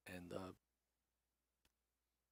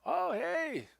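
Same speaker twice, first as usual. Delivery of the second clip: loud, high-pitched hearty welcome; friendly innkeeper; 9 dB louder than usual. Acted. Innkeeper greets the returning heroes, friendly and loud. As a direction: hail returning guests in a big, high voice. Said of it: "as friendly as ever".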